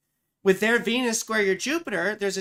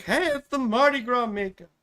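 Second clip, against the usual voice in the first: mock teasing voice; 5 semitones higher, 4 dB louder than usual.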